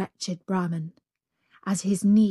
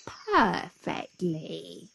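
grinning; much slower than usual. Acted. The jackal shuts the tiger in, slow and smug. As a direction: savour the trap. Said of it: "grinned the jackal".

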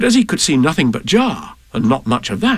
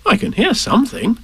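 higher, lightly voiced boy; boy's voice; 7 semitones higher than usual. A narrator's reading. Christopher Robin listening near the trap, lightly boyish. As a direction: answer as a curious child.

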